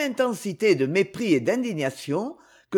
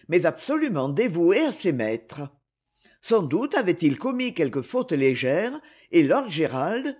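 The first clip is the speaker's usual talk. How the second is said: plain reading voice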